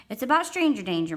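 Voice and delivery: put-on loud, high kid insistence; insistent little brother. A narrator's reading. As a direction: insist as an impatient small boy.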